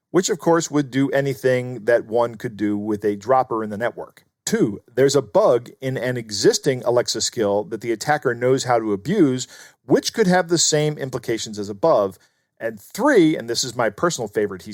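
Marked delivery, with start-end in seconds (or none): none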